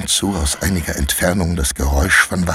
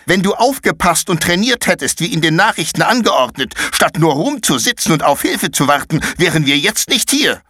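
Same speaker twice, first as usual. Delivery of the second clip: put-on much higher, cutting girl's reproach; scolding; 10 semitones higher than usual. Paula scolds Konrad for not training. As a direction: lecture him hard.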